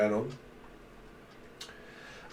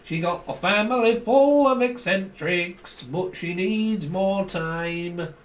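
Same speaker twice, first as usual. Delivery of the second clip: high, slow, drawn-out Scouse accent; exaggerated Scouse; 5 semitones higher, much slower than usual. Shown as a demonstration. Showing Americans the accent, exaggerated Scouse. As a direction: stretch the Scouse right out, pitched up. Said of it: "That's a bit, you know, exaggerated".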